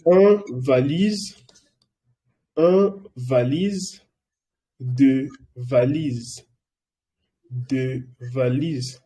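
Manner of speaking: very slow careful repetition of each word; teacher drilling valise slowly for the class. Shown as a demonstration. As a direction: stretch every syllable for learners to repeat. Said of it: "Estoy repitiendo muchas veces".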